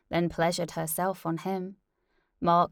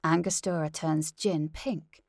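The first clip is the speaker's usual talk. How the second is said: ordinary delivery, nothing marked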